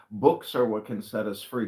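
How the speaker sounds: normal talking voice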